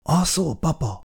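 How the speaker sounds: soft, hushed question to her sleeping husband; quietly checking if he's awake; softly asking whether papa is asleep; softly calling. A narrator's reading. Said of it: "Halkan szólogatta".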